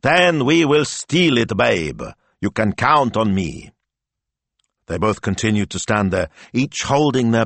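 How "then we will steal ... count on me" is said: slow, high, declaimed promise; melodramatic hero. Acted. Smilzo acting the escape scene, melodramatic. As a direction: declaim it like a film hero pledging help.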